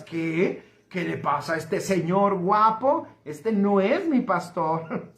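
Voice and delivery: deep, mock-indignant objecting voice; indignant listener. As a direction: play a shocked churchgoer scoffing. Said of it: "y digas".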